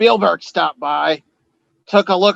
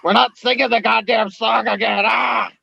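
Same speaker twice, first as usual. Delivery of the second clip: louder, higher exasperated refusal; mock exasperated shout; 6 semitones higher, 3 dB louder than usual. Host shouts that they won't sing the song again.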